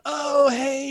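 embarrassed realization, high shout; embarrassed oh hey. Retold or imitated. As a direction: caught out, scramble to greet. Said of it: "I kinda gave him the".

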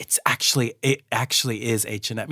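low, hushed aside; softly confided, voice dropped; retold whisper. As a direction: imitate a discreet murmur to a colleague. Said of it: "whispered to Michelle".

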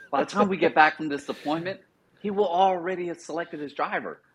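imitated sergeant brushing off a worry; imitating platoon sergeant. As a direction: lift the pitch, playing the confident sergeant. Platoon sergeant reassuring him, acted.

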